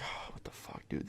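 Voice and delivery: hushed, whispered disbelief; frightened whisper. Retold or imitated. Narrator at stranger's door as blinds snap shut, frightened whisper. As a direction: whisper it under your breath in alarm.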